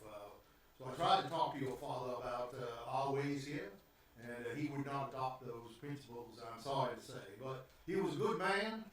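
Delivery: put-on southern-accented gentleman, measured. Vargas consoles the late man's daughter, southern accent. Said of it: "Why does he got a southern accent?".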